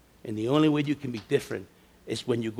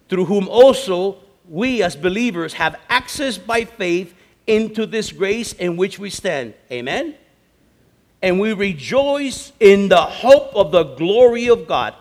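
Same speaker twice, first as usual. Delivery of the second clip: scripture proclamation; 7 semitones higher, 12 dB louder, slower than usual. Real, not acted. Declaims scripture.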